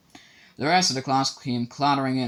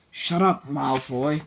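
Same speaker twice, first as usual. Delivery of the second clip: angry; 3 semitones higher, much slower than usual. A narrator's reading. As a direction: fire it back. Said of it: "said Harry angrily".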